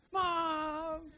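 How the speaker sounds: put-on high, loud whiny kid crying "Mom!"; child wailing for mom. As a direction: whine like a kid wanting mom.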